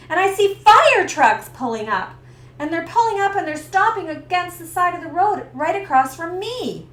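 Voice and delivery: rising alarm, pushing harder; building panic. Retold or imitated.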